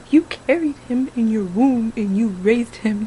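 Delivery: ordinary delivery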